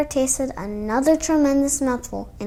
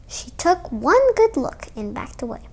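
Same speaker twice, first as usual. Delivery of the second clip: ordinary delivery, nothing marked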